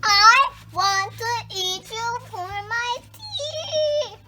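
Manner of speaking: put-on a child's playful animal voice, slow and high; cow's mock shout. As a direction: drag it out like a hungry cow. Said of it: "shouted the cow".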